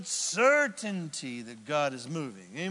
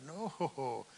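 normal talking voice

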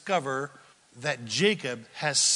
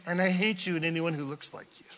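ordinary talk

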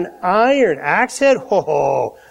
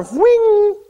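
high ringing "whing" sound; flying-object noise; 13 semitones higher, 3 dB louder than usual. Retold or imitated. Preacher imitating the axe head flying off, high ringing whizz. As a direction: imitate something whizzing off through the air.

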